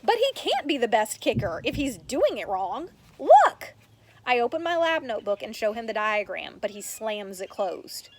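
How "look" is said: loud, very high cry; exclamation. Acted. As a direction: burst out to get attention. Urgently showing the diagram.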